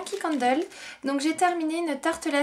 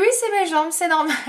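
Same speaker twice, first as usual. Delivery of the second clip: higher, much louder quoted comeback; lively retold reply; 4 semitones higher, 7 dB louder than usual. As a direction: punch out the retold answer, high and loud. Réplique racontée à son chéri. (réplique enjouée rapportée, plus haute et plus forte)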